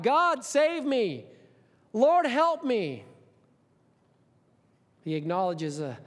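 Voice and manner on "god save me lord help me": slow, high, loud pleading cry; crying out to God. Acted. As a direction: beg God for rescue, drawn out. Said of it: "And he's crying out to God".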